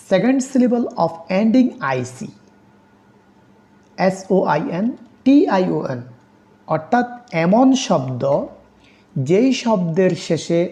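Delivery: ordinary talk, nothing marked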